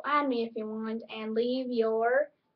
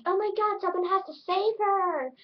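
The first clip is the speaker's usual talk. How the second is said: mock alarm; 8 semitones higher than usual. Acting alarm at someone fainting.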